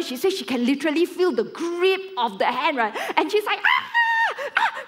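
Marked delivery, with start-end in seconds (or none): 3.6-4.8 very high-pitched shriek of fright; mock terrified scream. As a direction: scream like the scared girl. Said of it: "She's so much more scared".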